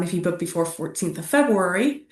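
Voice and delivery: ordinary delivery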